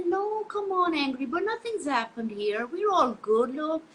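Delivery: high, bright-eyed brushing it off; cheerful dismissal. Retold or imitated. To a worried friend, high cheerful brush-off. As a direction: breezily insist everything's fine. Telling friend all is fine.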